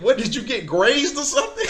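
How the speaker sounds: high, loud, amused disbelief; retold laughing question. Man laughing at the shot caller's news, amused and incredulous. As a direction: imitate him laughing through the question. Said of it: "he started laughing".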